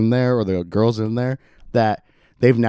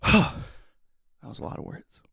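breathy aside; 6 dB quieter, slower than usual. Real, not acted. Exhausted aside after sigh.

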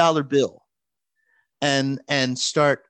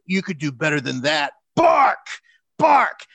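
Ted DiBiase taunting; 6 dB louder than usual. Retold or imitated. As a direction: order the fan around like a rich villain who owns him. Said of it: "Ted DiBiase's like".

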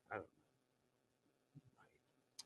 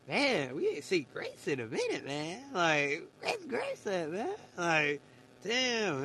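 imitating an excited friend, raised and louder; excited homie; 5 semitones higher, 6 dB louder, slower than usual. Homie asking streamer where Grace is, excited. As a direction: retell your buddy's eager pleading, pushed louder. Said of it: "even he gets excited sometimes".

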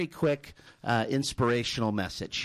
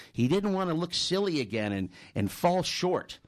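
normal talking voice

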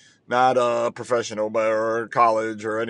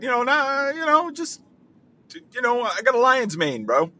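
high bro voice; 10 semitones higher than usual. Acted.

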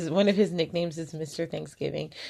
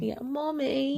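mock British accent; 8 semitones higher, 6 dB quieter, slower than usual. Shown as a demonstration. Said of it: "three things in a British accent".

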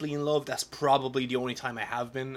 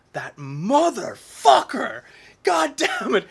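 slow, loud, much higher hammed-up curse; mock furious outburst; 10 semitones higher, 9 dB louder, slower than usual. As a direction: bellow it in fake rage at the TV. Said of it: "and I was like".

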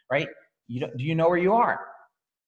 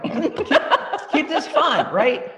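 excited urging; 11 semitones higher, 5 dB louder, slower than usual. Real, not acted. Laughing, urging team loudly.

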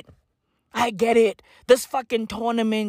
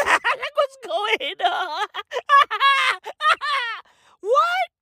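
high, incredulous words through big laughs; helpless laughter; 13 semitones higher, slower than usual. Real, not acted. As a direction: lose it laughing mid-question. Cracking up at own joke.

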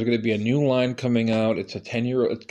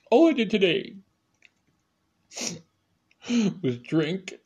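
high whiny plea; 7 semitones higher, slower than usual. Retold or imitated.

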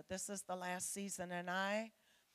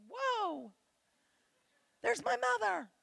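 high, louder exclaim of surprise; startled whoa; 13 semitones higher, 9 dB louder, slower than usual. Retold or imitated. Seeing her reflection and spotting her mother, surprised. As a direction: jump up in pitch as if caught off guard. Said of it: "passed my reflection in windows and went".

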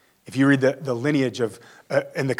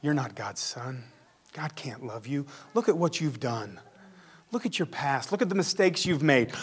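accusing voice; 8 dB quieter than usual. Retold or imitated. The devil's voice listing your failures, softly needling.

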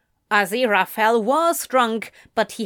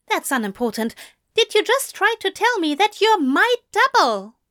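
much higher, louder, embarrassed denial; flustered protest; 9 semitones higher, 3 dB louder than usual. Acted. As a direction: deny it all in a blushing rush. Angel blushes, protests.

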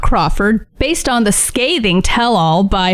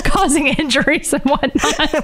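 laughing; 5 semitones higher than usual. Real, not acted. Laughing through words.

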